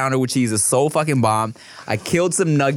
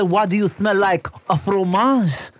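put-on French accent; 7 semitones higher than usual. Playing snooty French food lover.

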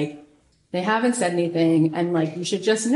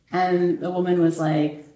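ordinary talk

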